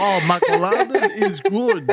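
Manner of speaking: a hint of a mock Russian accent; slow, high, laughing Russian guy. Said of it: "a Russian kind of guy".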